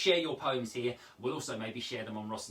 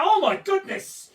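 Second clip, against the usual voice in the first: a much higher, much louder yelp of shock; shout; 13 dB louder, slower than usual. Acted. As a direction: blurt it as the oven heat hits you. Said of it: "and you must shout".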